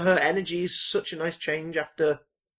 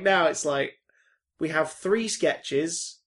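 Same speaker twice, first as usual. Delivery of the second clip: normal talking voice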